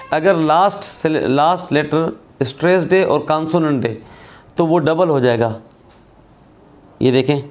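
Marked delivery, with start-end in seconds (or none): none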